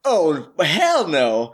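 outraged. Acted. After being smacked.

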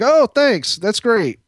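high, airy acted-out thank-you; lilting, high reply; gracious thanks. Acts out celebrity thanking a fan.

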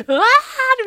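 high, loud squealing shriek; excited scream. Retold or imitated. Calling another friend with the news, screaming. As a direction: scream just as wildly at the next friend. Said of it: "samma, samma visa".

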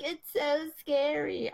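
high, slow whiny mock fright; a mocking, scaredy-cat whine; mocking scared friends. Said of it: "And everyone's like".

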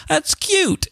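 high cooing "That's cute"; lightly mocking gushing adult. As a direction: coo it like a charmed adult. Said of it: "adults would read that out loud and say".

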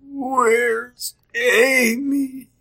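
weak, high, very drawn-out words; throbbing-throat croak. Acted. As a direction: strain to get out a few words through a sore throat. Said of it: "My throat is throbbing".

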